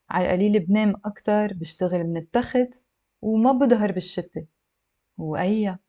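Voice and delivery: fluent, slightly brisk model reading; connected speech with shorter pauses. Shown as a demonstration. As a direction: run the phrases together. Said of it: "I'll read it a little bit faster".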